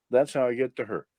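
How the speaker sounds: normal talking voice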